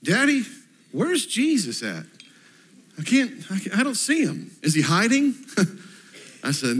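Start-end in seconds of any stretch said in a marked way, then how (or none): 0.0-5.3 put-on higher, small little-girl voice; four-year-old daughter. Pastor voicing his little daughter asking about Jesus, higher childlike voice. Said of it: "my little four-year-old says".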